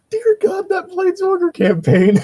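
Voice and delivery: high, laughing and groaning at once; laugh-groan in disbelief; laughing despair. Real, not acted. Player groaning at the chaotic campaign, laughing.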